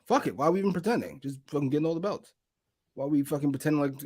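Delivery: ordinary delivery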